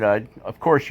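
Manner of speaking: normal talking voice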